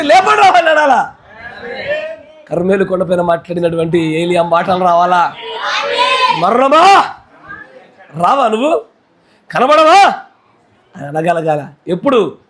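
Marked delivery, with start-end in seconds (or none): 0.0-10.1 defiant shout. Real, not acted. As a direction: challenge death triumphantly at full voice. Calling death out, shouting. (మరణాన్ని సవాలు చేస్తూ గట్టిగా అరవడం)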